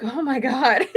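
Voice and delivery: breathless, laughing delight; laughing reaction. Real, not acted. Watching the actor dance on the beach, laughing. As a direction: let the laugh take over.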